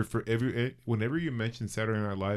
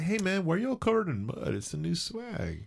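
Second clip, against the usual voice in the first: mock classmate voice; 10 semitones higher than usual. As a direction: play a puzzled kid, then cool. Imitating kids at school.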